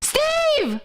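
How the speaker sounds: high-pitched squirrel exchange; high cartoonish meme squirrel; squirrel meme impression. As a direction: ask and answer in a high squirrel voice. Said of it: "It's like".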